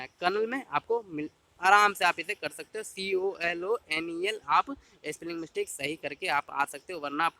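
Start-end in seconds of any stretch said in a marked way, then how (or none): 2.8-4.4 सीओएलओएनईएल spelled slowly, letter by letter; spelling it out. Shown as a demonstration. Teacher spelling colonel for exam students, slow and clear. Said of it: "जैसे लिखा हुआ है वैसे ही पढ़ना है".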